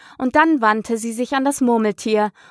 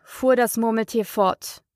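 ordinary delivery, nothing marked

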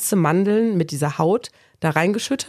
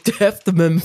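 laughing; slower than usual. Real, not acted. Laughing echo of a joke.

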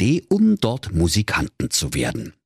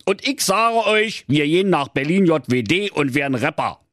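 high-pitched, insistent donkey; exasperated donkey; 14 semitones higher than usual. Acted. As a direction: lay down the law as the donkey. Donkey asserts his authority. (genervter Esel spricht ein Machtwort, hoch)

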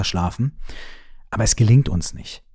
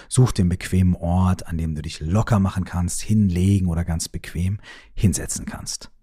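ordinary talk, nothing marked

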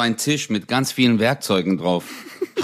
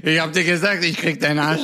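higher threat broken by laughter; laughing threat; 6 semitones higher than usual. Pretend. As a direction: try to menace but crack up.

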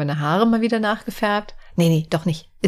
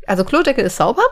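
ordinary delivery

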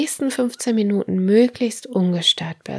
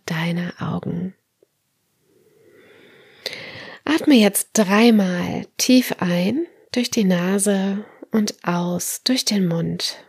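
guided meditation; slower than usual. Acted. Leads autogenic training relaxation.

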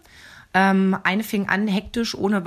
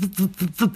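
imitated fetal heartbeat, repeated whooshing pulses. Mother recalling baby's heart tones, imitating the pulse. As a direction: mimic the monitor's thudding heart sounds. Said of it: "Diese Herztöne gingen".

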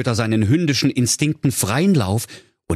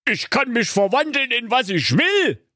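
gloating wizard. A narrator's reading. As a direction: boast it out with spiteful pride. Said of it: "lachte hämisch und rief".

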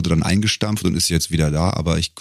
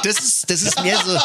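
much higher, squeezed through laughter; laughing; 11 semitones higher than usual. Real, not acted. Host laughs through the words at the urine joke. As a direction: barely get the words out for laughing.

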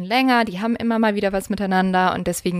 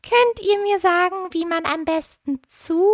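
much higher, eerie stranger voice; high creepy voice; 10 semitones higher than usual. Acted. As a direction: ask sweetly in a creepy high pitch. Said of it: "mit hoher Stimme gefragt".

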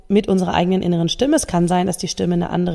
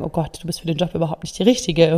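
doubting inner voice; 3 semitones lower, faster than usual. Retold or imitated. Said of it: "diese Zweifelstimme an zu sagen".

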